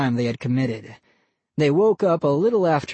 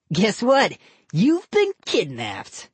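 put-on slow sly taunting boy; teasing kid voice; 6 semitones higher, slower than usual. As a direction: drag out the gotcha. Boy character voice.

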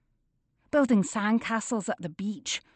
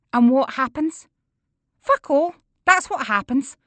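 angry rant; 6 semitones higher, 6 dB louder than usual. A narrator's reading.

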